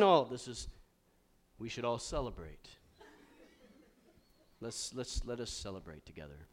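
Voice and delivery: soft, low and flat, a bored churchy drone; mock stiff solemnity. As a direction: parody a Jesus with no joy in him. Mocking a stoic Jesus.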